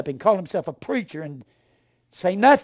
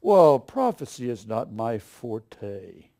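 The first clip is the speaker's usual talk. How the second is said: quoting the preacher; 5 semitones lower, much slower than usual. Retold or imitated. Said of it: "this Baptist preacher said".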